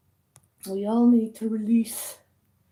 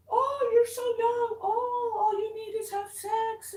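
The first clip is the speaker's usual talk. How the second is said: mocking taxi driver; 10 semitones higher than usual. Retelling what the taxi driver said, in a mocking high whine. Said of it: "he's like".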